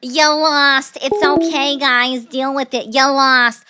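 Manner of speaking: loud gloating taunt of you lost; taunting shout. Real, not acted. Streamer taunts Trump supporters who lost, loud gloating shout. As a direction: yell it out, rubbing in the loss.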